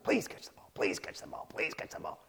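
hushed, repeated whispered plea; imitating a scared boy begging under his breath; desperate whisper. Smalls waits for the ball, whispering desperately. Smalls pleading to himself, eyes shut.

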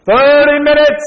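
high, loud drawn-out shout; barked time warning. Acted. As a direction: yell it across a huge exam hall. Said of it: "barking out how much time was left".

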